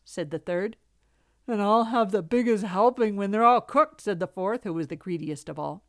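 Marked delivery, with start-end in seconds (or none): 1.5-4.0 loud, put-on cub voice; greedy little fox. A narrator's reading. Greediest fox claiming the biggest helping, greedy.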